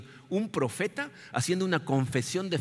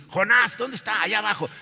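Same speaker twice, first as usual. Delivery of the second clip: loud, higher call below deck; sailors calling out; 6 semitones higher, 5 dB louder than usual. Acted. Sailors hunting for Jonah below deck, calling out loud. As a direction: shout down to find him.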